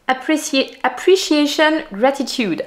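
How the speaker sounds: English words in a French accent; English spoken with a native French accent. Real, not acted. Knitting vlogger reading colour meanings to viewers, French-accented English. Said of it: "apprécier mon accent".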